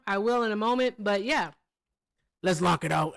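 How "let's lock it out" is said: lower, gruff attempt at Hector's voice; a botched try at Hector, came out like [name]. Shown as a demonstration. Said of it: "that didn't sound like him. That sounded like D M X".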